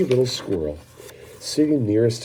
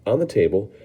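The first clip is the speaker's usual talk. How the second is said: plain reading voice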